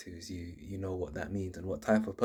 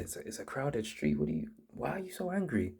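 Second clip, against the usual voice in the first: puzzled higher-pitched thought; mock bewilderment, pitched up; confused; 6 semitones higher, 4 dB quieter than usual. Bumped passerby thinking to himself, confused. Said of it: "you're just confused".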